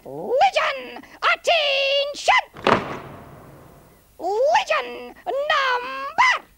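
very high, drawn-out military shout; squealed, long-held marching orders; parade-ground drill commands. Acted. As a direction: stretch each command like a drill sergeant.

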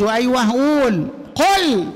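teacher snapping out the correction قل; correcting to قل. Shown as a demonstration. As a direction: push قل up sharply as the fix. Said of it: "correcting student's word".

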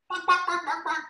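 high squeaky panicked bark bark; frightened chicken squawks. Shown as a demonstration. Showing kids the frightened chicken sound. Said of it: "make the sound of very frightened chickens".

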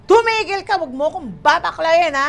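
high, loud sharp rebuke; director snapping. Retold or imitated. Director snapping at the actress, high and loud. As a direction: cut her off sharply.

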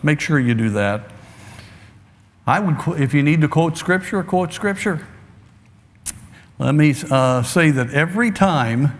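usual speaking voice

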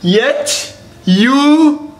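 letters drawn out, each one stretched; slow spelling out. Acted. Teacher spells the next word to students, very slowly. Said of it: "Next word, students".

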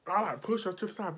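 ordinary delivery

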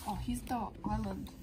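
usual speaking voice

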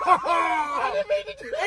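laughing apology. Real, not acted.

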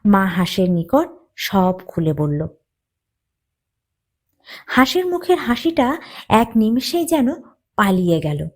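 usual speaking voice

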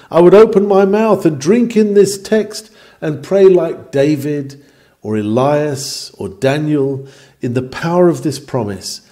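ordinary delivery

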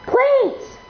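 shrill begging yelp; high pleading cry. Real, not acted.

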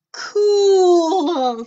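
high loud delighted cool; impressed. Acted. Bork cheering the robot's end, high and loud. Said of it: "said Bork".

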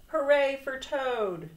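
high, loud hooray; shouted cheer. A narrator's reading. Frog shouting from the theater seats, cheering. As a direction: cheer from the audience. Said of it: "as he shouted".

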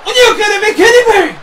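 loud, high-pitched incredulous commentary; shouted disbelief. Real, not acted. Commentator baffled by the kick-out, shouting in disbelief. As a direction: cry out in stunned disbelief.

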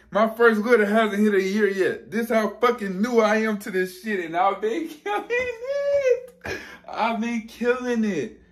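high, tearful laughing pride; emotional excitement. Real, not acted. Breeder marvelling at his first year, laughing through happy tears. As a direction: crack into a laugh-cry of disbelief. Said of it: "I'm so excited".

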